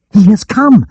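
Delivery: high, loud excited cry; a breathless messenger shouting good news. A narrator's reading. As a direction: burst out with the news. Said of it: "cried Much".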